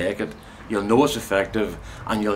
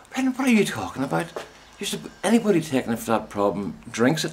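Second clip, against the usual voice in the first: higher, quicker imitated woman, confused; baffled chemist; 8 semitones higher than usual. Chemist confused by bowls talk, higher mock bafflement. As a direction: lightly voice her bewilderment. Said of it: "She was".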